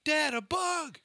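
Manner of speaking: put-on high excited little-kid call; toddler squeal. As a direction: call out like a two-year-old spotting a bug. Toddler voiced in story.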